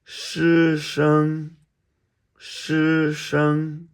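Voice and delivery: "师生" held high and level; first tone. Shown as a demonstration. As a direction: sustain both syllables of "师生" on one high note. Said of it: "All first tones".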